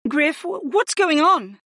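high, stammering alarmed question; alarmed cry. A narrator's reading. Mage rushes in seeing red moon, alarmed. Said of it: "Nia Kennefic cried out".

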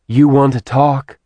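irritated snap; testy. A narrator's reading. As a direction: needle her testily. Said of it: "Zarina said testily".

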